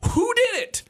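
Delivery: high squeaky, innocent "who did it"; comic falsetto of clueless passengers; mock bewildered crowd. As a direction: play the whole cabin shrugging dumbly. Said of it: "everybody went".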